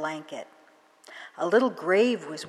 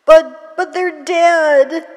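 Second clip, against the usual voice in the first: much higher, louder, slow tearful stammer; choked with tears; 11 semitones higher, 14 dB louder, slower than usual. Acted. Girl protesting to the crone, higher, louder voice choked with tears. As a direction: sob the protest out. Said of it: "her voice choked with tears".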